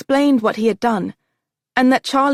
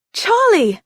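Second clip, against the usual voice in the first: high joyful cry of a name; excited scream; 10 semitones higher, 4 dB louder than usual. A narrator's reading. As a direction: call his name out in delight. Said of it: "she screamed".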